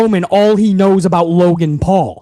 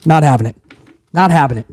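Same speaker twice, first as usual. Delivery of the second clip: not having it; 7 semitones lower, faster than usual. Real, not acted. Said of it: "I'm on it today".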